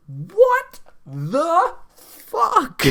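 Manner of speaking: very slow, loud drawn-out what-the; exasperated outburst. Real, not acted. Fan ranting at the credits, slow loud disbelief. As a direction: drag every word out in disbelief.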